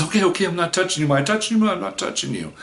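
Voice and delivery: high, fast, panicky; a rattled girl backing off quickly; freaked out. Retold or imitated. Cat girl freaking out at his yelling, panicky and fast. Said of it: "freaks out and says".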